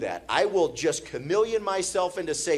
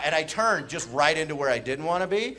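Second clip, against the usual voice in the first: usual speaking voice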